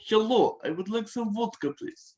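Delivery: put-on Russian accent, starting high. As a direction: order a drink in a Russian accent. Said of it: "just a normal Russian accent".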